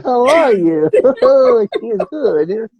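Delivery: put-on high chuckling old puppet voice; giggly ventriloquist grandpa; Robinson McAllister. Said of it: "aqui está Robinson McAllister".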